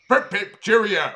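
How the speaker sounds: put-on English accent, cheery; mock English accent. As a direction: chirp it like a caricature Englishman. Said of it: "pretending to be English".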